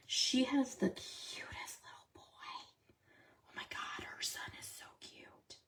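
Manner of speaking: soft breathy whisper, no voice. Real, not acted. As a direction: whisper it so he can't hear. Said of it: "I have to whisper".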